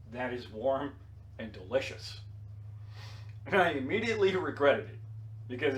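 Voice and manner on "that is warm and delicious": softer, lower, deliberate repeat; quiet repeat. Retold or imitated. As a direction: say it again quietly, each word apart. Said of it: "I said".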